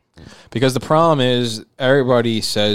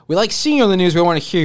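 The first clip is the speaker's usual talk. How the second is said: lightly voiced cartoon kid, higher; a hint of a bratty cartoon kid; Cartman voice; 6 semitones higher, 3 dB louder, faster than usual. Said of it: "And Cartman tells Token".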